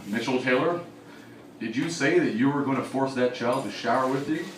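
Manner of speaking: low, formal, softly acted accusation; mock accuser. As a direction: question him like a solemn prosecutor. Imagined formal accusation against him.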